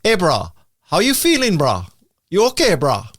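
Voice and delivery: casual Hawaiian Pidgin, "how you feeling" jumping high; laid-back Hawaiian Pidgin hello; Hawaiian Pidgin greeting. Shown as a demonstration. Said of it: "You'd probably go like".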